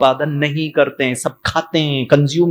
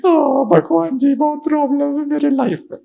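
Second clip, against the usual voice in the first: whining devotee; 13 semitones higher than usual. Retold or imitated. Devotee wailing problems to God, high mock sob. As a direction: cry to God like a child.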